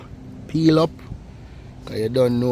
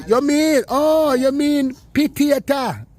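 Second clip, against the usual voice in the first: drawn-out Jamaican patois, much higher and slow; Jamaican market woman; 12 semitones higher, 3 dB louder, slower than usual. Retold or imitated. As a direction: market woman finally getting it, in a slow, high, broad patois. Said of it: "And the woman say".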